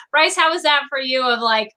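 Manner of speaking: ordinary delivery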